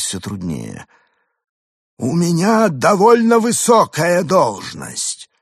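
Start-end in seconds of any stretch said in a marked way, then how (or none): 2.0-5.3 high, slow self-important alien; drawn-out alien boast. A narrator's reading. Arthur's alien voice. (высокий медленный голос пришельца)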